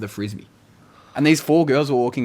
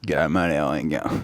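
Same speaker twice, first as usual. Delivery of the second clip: low voice for a mate; blokey greeting; 7 semitones lower than usual. Shown as a demonstration. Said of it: "My mate comes in".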